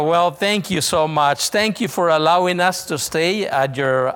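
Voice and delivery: normal talking voice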